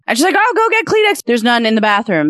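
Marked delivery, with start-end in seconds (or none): 0.4-1.2 high mock woman's voice; retelling the joke's woman, voiced high. Woman voiced in retold joke.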